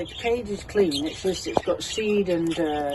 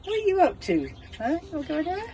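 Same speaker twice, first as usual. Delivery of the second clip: much higher coaxing pet voice; 11 semitones higher, faster than usual. Real, not acted. Owner coaxes a bird to climb on in high baby talk.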